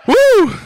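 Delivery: short high "woo"; high whoop. Real, not acted. Preacher praying over someone, high whoop. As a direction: let out a revival whoop.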